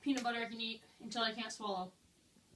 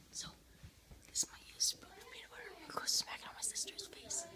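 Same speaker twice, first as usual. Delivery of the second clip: high, fast, scheming whisper; 9 semitones higher than usual. Real, not acted. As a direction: whisper the prank plan. Secret prank plan.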